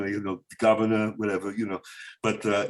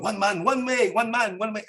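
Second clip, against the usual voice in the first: put-on Romanian accent; 9 semitones higher, slower than usual. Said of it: "everyone's going".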